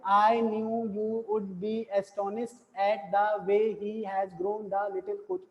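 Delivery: slow monotone, every word alike; subdued, level demonstration of reading without intonation; flat tone, no variation. Shown as a demonstration. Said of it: "अभी ऐसे पढ़ें".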